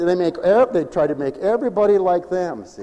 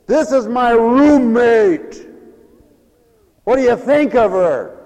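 sarcastic slow mock; 6 semitones higher, 6 dB louder, slower than usual. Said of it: "I don't want to agree".

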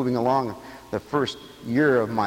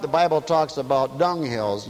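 normal talking voice

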